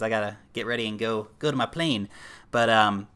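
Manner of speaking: ordinary talk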